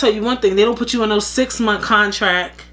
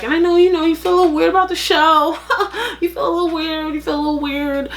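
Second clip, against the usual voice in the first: mock boss teasing; 7 semitones higher than usual. Boss needling Amanda about the show, mock concern. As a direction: needle her with fake concern.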